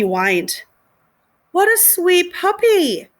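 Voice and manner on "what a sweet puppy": high, slow cooing lady voice; sweet woman. A narrator's reading. Woman cooing at a puppy, high and slow. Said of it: "a woman asked".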